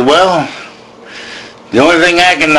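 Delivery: normal talking voice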